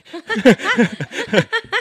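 high squealing laugh; burst of laughter. Real, not acted. Host laughing at her own joke, high squeal.

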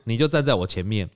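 ordinary delivery

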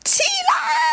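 very high drawn-out shouted command; angry shout. Acted. Hero ordering the naked woman up, angry shout. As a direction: yell it out long and high. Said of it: "指着女人大叫".

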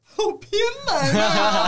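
high, loud, laughing cry; laughing exclamation. Real, not acted. Host squeals at an odd favourite food, laughing.

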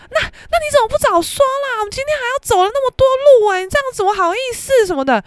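high, fast, whining imitation of him; mock his reproachful whining; whiny blame. Boyfriend complains she didn't say.

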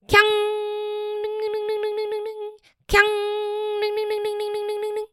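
high, soft ding-ding bell sound; imitated bell ringing. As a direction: ring out like a ship's bell.

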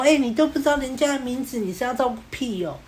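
high-pitched imitation of a scolding girl; girl's retort. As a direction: play a girl telling off her friend, pitched high. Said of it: "然后妹子就说".

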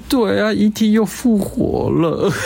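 hammed-up crying voice, drawn out; mock teary whine. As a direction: imitate a coworker sobbing over a film. Voicing crying coworker.

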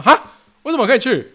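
mother caught off guard; mom's startled huh. Retold or imitated. Mom surprised at news.

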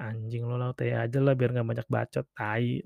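low muttered mock grumble; quiet, low, mock-irritated aside; curt, snarky reply. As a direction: grumble it dismissively under your breath. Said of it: "Nanti balasan jutek gue".

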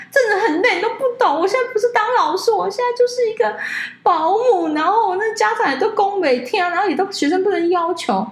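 whiny teacher. Retold or imitated. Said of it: "然后他就跟我说". (学着老师高声抱怨的语气)

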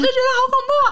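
fast, high, tearful wail; retold fear. Mother retelling dropping her baby, high tearful wail. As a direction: relive the panic with a sob.

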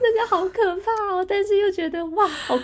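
high, laughing fangirl gush; half-scared, half-delighted high-pitched exclaiming; thrilled fright. Retold or imitated. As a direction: squeal it high, then settle into delight. Reliving her thrilled reaction to the scene.

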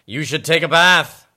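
loud, bold hippo voice. A narrator's reading. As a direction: voice the hippo with gusto. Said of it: "says the hippo".

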